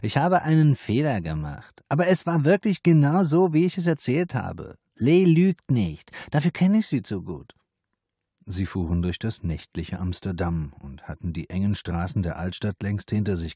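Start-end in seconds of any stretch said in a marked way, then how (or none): 0.0-7.5 high, loud insistent madam voice; madam vouching for the girl; pleading madam. A narrator's reading.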